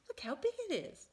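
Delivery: high, surprised squeal; amazed. Acted. Missus Bird amazed at the huge egg, pitched high. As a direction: gasp at how big it is. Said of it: "said Missus Bird".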